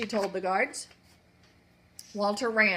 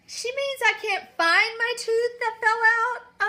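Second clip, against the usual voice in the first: put-on small boy voice; 12 semitones higher, 11 dB louder, slower than usual. Walter nervously explains to the queen.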